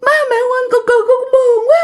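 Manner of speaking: put-on very high, tearful girlish whine; pleading to his parents in a high crying girlish voice; whiny girlish crying. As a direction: sob it out like a pouting little girl. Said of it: "theo cái kiểu như con gái vậy đó nha các bạn, kiểu nhõng nhẽo á".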